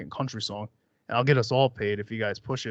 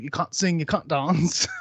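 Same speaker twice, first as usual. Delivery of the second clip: mock British accent; 4 semitones higher than usual. Repeats the British judge catchphrase, laughing.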